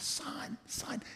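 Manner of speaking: hushed, half-whispered pleading; very soft, tender private appeal; father entreating. Acted. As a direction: coax him gently in a soft whisper. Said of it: "the father comes out and says".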